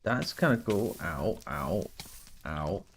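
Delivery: usual speaking voice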